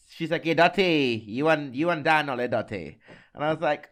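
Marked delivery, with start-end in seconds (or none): 0.4-3.0 loud, higher Jamaican British accent, imitated. Hairdresser hailing the podcast hosts, imitated Jamaican British accent. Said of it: "in her very strong, like, Jamaican British accent".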